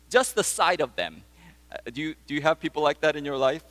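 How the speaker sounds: ordinary talk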